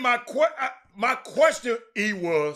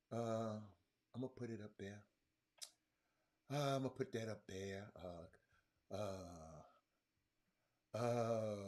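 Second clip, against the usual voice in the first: much softer and lower, held down; 10 semitones lower, 15 dB quieter than usual. Real, not acted. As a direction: keep it hushed like you promised. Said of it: "I'm about to lower my voice now".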